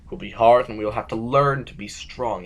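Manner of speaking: loud, forceful rebuke; stern outburst. Acted. Sovereign scolding Burgess for pretending, loud stern rebuke. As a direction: slam the reality of war on him.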